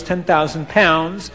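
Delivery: usual speaking voice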